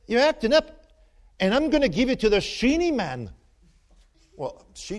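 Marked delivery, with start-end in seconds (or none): 0.1-3.3 loud, high scolding grandma; grandmother's threat. Retold or imitated. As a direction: scold like a stern old Irish grandma. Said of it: "She said".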